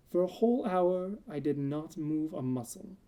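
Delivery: plain reading voice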